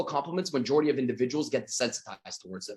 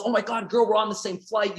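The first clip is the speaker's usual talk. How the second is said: thrilled to share the news; squealing excitement; 8 semitones higher than usual. Pretend. Jennifer texting her girls, squealing excitement. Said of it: "She's texting her girls".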